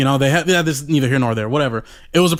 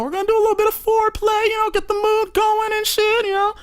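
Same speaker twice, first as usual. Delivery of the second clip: high pitched. Real, not acted. Mocking his own hopes.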